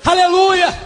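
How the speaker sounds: high, ringing hallelujah; exclaimed praise. Real, not acted. Preacher praising God before the congregation, exclaimed praise. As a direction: cry out praise on a high pitch.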